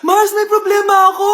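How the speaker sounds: loud tearful plea; crying out. Acted. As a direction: wail it to your friend. Friend arrives in distress.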